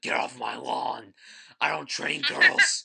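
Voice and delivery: put-on gruff old man barking off his lawn; shouting at trespasser. Clint Eastwood quote impression.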